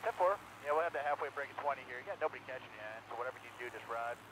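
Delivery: quick radio call over team channel; talking to the driver by radio; race spotter radio. Real, not acted. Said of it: "that session of Crank It Up".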